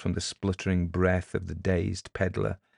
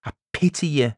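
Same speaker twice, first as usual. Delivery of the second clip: raised, condescending pity; mock-kindly pity; 6 semitones higher, 6 dB louder than usual. A narrator's reading. Elder talking down to the beaten peddler, kindly. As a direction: pity him from on high. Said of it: "said Charlesy kindly".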